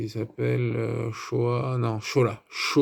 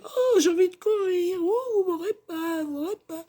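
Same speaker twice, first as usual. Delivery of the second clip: very high falsetto wail, fading off; hammed-up woman fleeing in squeaky panic; mock damsel cry. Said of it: "Et là, la femme, elle fait".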